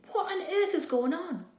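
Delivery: plain reading voice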